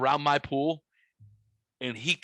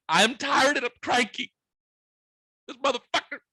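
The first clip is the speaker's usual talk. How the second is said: high, strained, cranky complaint; whine-yell your exasperation, reenacting it; retold mad rant; 9 semitones higher than usual. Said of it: "I was mad".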